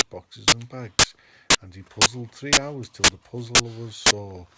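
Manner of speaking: loud, building climax read; dramatic story reading. Acted. Reading the Grinch's change of heart to the room, big and loud. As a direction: bring the Grinch's realisation home with full force. Reads the climax at full force.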